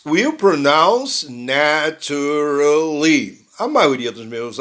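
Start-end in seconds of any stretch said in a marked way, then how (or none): 1.3-3.5 slow "naturally" as na-tu-ra-ly; every vowel of "naturally" sounded out; students' four-syllable naturally. Shown as a demonstration. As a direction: stretch "naturally" over four full beats. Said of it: "With four syllables".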